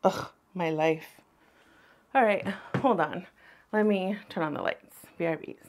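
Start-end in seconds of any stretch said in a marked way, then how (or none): 0.0-1.2 slow, low sighing groan; dramatic sigh. Real, not acted. Groan at her situation.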